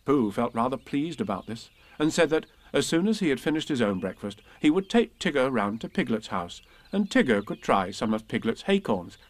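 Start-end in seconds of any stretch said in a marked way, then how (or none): none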